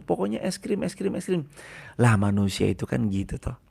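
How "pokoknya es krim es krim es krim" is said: put-on whining child. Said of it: "kita nangis luar biasa".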